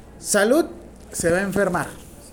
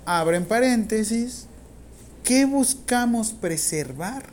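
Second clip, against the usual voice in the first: slow, drawn-out words, pitched up; slow dictation; 4 semitones higher, slower than usual. Real, not acted. Teacher dictating the first question to students, slow dictation. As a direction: dictate it slowly for note-takers.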